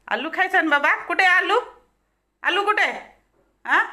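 high, loud questions to the dog; excited teasing. Real, not acted. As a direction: tease an eager pet, loud and high.

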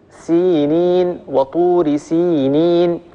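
سينين وطور سينين slowly, each ee held long; lengthened vowels. Shown as a demonstration. Teacher reciting an example of madd ya, lengthened vowels. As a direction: stretch both ya sounds in سينين. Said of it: "وقابلية التطويل وقابلية الزيادة".

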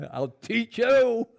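high, loud, slow retort through laughter; laughing mock threat. Parent answering a doubting child, laughing mock threat. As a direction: stretch out a playful threat, laughing.

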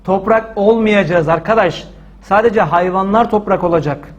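ordinary delivery

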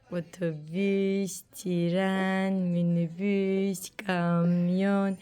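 very slow, low build-up; playful countdown. Pretend. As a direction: stretch every word out, holding back the beep. Slow build-up repeated for child.

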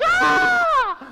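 high, loud imitated scream; piercing panicked yelp, acted; alarmed shriek. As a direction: shriek like a parent seeing danger. Imitates alarm at toddler holding knife.

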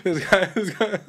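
high squeaky giggling words; can't stop laughing through the words. Real, not acted. Cracking up at the paused story, high giggly voice.

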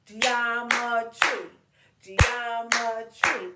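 Geometry slow and loud, syllable by syllable; slow, loud chant of Geometry in even beats; clapped syllables. Shown as a demonstration. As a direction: beat out every syllable of Geometry. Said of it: "Clap it".

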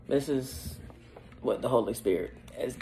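usual speaking voice

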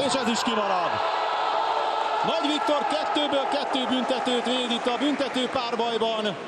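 high shouted call of the save; excited hungarian sports commentary. Real, not acted. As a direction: shout it out, pitch way up. Second save in shootout.

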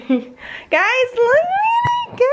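thrilled squeal showing gifts; excited. Real, not acted.

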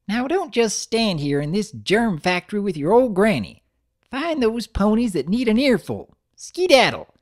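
put-on high, creaky-old grandmother voice; old granny voice.

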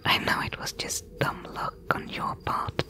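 ordinary talk, nothing marked

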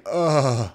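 dazed gamer groan; zombie-like groan. Retold or imitated. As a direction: grunt it out like you're dazed. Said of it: "the sound I made".

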